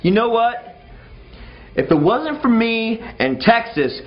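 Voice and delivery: high, loud comeback shout; defiant shouted retort; retold yell. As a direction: snap back at the Frenchman. Jumping up, yelling back.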